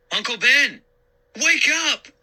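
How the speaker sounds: high, loud desperate shout. A narrator's reading. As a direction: shout to wake him. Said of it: "I shouted".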